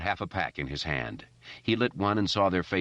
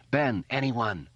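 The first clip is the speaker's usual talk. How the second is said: shouting; 6 semitones higher, slower than usual. A narrator's reading. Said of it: "he shouted again".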